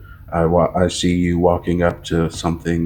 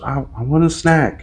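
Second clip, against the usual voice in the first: higher-pitched child imitation; imitating his kids; 6 semitones higher than usual. Dad mimics kids asking for food, high voice. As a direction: pitch it up like a small kid. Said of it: "And then they're just".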